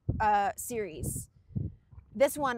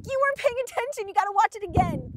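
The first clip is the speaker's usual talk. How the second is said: high, fast mock scolding; exasperated scolding; 10 semitones higher, 5 dB louder, faster than usual. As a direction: scold your mom in playful, high-pitched exasperation. Retold scolding of mom.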